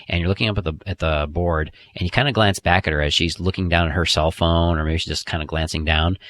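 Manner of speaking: normal talking voice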